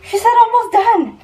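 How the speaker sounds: high loud effortful cry; strained shout. Real, not acted. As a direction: push the words out mid-strain. Exertion near end of workout.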